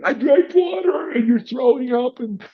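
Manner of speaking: very high, squeaky mock distress; hammed-up choking kid squeal; panicked child impression. Child to dad after being dunked, mock panic.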